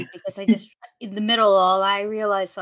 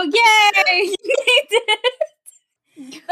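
squealing, much higher and louder yay; excited cheer; 13 semitones higher, 8 dB louder, much slower than usual. Acted. Player cheering friends back over the stone, high and loud. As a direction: shriek it out with joy, stretched slow. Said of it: "And I go".

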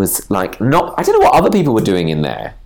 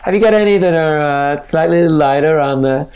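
put-on American accent; 6 semitones higher than usual. Customer fussing over vaccine ingredients, in a higher American accent. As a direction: put on a really American customer, stretching the words. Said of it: "like really American".

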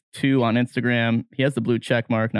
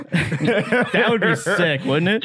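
laughing; 6 semitones higher, much slower than usual. Real, not acted. Host laughing at draft joke.

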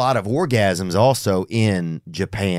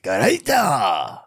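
drawn-out mock Japanese exclamation; slow, playful imitation of Japanese; mock Japanese accent; much slower than usual. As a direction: stretch out made-up Japanese sounds. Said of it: "see if it was something in Japanese".

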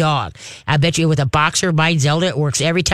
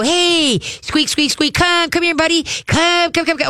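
high sing-song come here to the dog; high coaxing call; 12 semitones higher than usual. Shown as a demonstration. Trainer showing owner how to call the dog, high and coaxing. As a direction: coax the dog in with a bright high voice. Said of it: "you just gotta go".

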